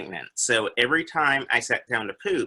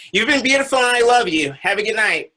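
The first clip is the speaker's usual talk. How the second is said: higher, louder and fast farewell; big sign-off; 11 semitones higher, 7 dB louder, faster than usual. Real, not acted. Comic signing off to the crowd, high and loud.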